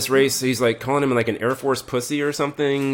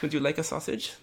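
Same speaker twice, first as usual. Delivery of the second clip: fake German accent; 6 semitones higher, 8 dB quieter than usual. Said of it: "They all talk with this".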